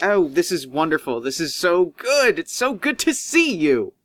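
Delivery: oily host gushing over a guest; gleeful Doctor Cook. Acted. Doctor Cook greeting the guest, gushing and gleeful. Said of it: "he's rubbing his hands together".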